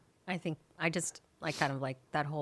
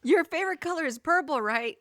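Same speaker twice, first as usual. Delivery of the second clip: quoting him; 10 semitones higher, 4 dB louder than usual. Retold or imitated. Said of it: "Brittany quoting him".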